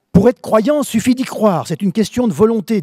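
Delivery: voix haute et forte de grand-mère; assène-le comme une grand-mère, haut et fort; grand-mère péremptoire. Acted. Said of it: "disait ma grand-mère". (grand-mère qui martèle son dicton)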